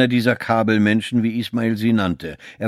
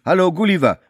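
higher, louder cheerful hail; raised-pitch call of greeting; 9 semitones higher, 5 dB louder, faster than usual. A narrator's reading. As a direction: call over the counter to summon someone.

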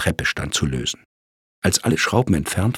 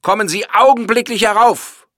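much higher, loud booming command; 13 semitones higher, 7 dB louder than usual. A narrator's reading. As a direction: let it roar through the vault. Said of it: "dröhnte die Stimme".

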